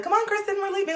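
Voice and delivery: put-on high bright girlfriend voice; friend calling her out. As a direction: pull her away, high-pitched. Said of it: "a girlfriend would just come and be like".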